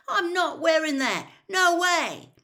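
high, loud indignant shout; yelled, appalled protest; outraged refusal. A narrator's reading. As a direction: flatly refuse at full volume. Boy loudly refuses dress robes.

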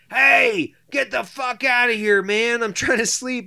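high, loud shout to scare it off; yelling at a bear. Retold or imitated. Man yells at a bear to leave, loud and high. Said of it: "I'm like".

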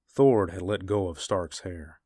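ordinary delivery, nothing marked

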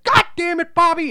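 high, loud furious shout; mock angry yell. Imitating the dad yelling at Bobby, loud and high. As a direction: blow up at Bobby. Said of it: "he's like".

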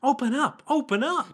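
put-on very high boy calling at a door; high-pitched young boy; Jacuzzi. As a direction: call out in an unbroken child's voice. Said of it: "One whose voice is yet to break".

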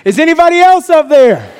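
high, loud holler up the cliff; yelling out. Acted. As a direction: shout up desperately for someone else. Man yells up for other help.

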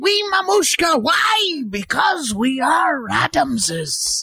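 high, slow hammy proclamation; Raul Julia. Acted. Comic playing the Addams father, grand Raul Julia voice. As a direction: declaim it with huge drawn-out theatrical relish. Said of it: "I have a solid nine".

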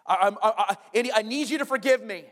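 stammering, high, loud plea; pleading father. Retold or imitated. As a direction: imitate a dad stumbling into an apology. Dad begging son for forgiveness.

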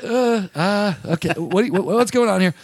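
low, hesitant, awkward recoil; uneasy stammer. Retold or imitated. Man leaning back from an unwanted touch, awkward stammer. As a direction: stall politely while backing away.

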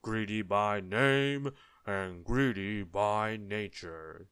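slow, booming giant voice; bellowing giant. A narrator's reading. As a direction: bellow it, stretching out the words. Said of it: "he bellowed".

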